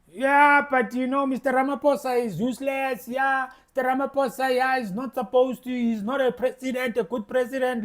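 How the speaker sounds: soft, dismissive imitation of a doubter; mocking critics. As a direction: mimic the grumbling critic under your breath. Mocking what critics will say.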